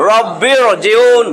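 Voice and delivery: রব্বির জিউন slow, r thick and heavy. Shown as a demonstration. Teacher drilling the student on heavy r, slowly. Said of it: "আরজি জের হইলে".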